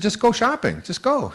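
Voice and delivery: high, insistent urging. Retold or imitated. Urging daughter to leave.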